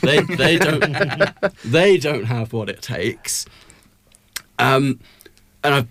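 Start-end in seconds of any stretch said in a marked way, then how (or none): 0.0-3.4 slow, high mocking drawl; mocking sneer. Mocking German rivals' lack.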